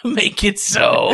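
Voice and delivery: slow, lightly voiced Picard command; a hint of a starship captain. Picard catchphrase after Picard talk.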